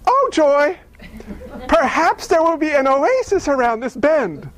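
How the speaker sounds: stranded driver cheerily hoping for an oasis; chipper optimist. Retold or imitated. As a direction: sing out blind optimism. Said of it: "they're just like".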